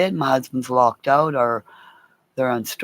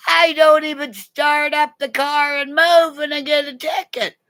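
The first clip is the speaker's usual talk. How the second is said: much higher, whiny Jose voice; imitating Jose; 12 semitones higher than usual. Jose complaining about his ticket, high and whiny. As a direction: squeak it like an aggrieved Jose. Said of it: "Because Jose said".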